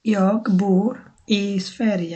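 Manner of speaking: very slow, careful Swedish repeat. Shown as a demonstration. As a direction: spell it out slowly for the class. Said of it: "আমি সুইডেনে থাকি". (খুব ধীরে Swedish বাক্য)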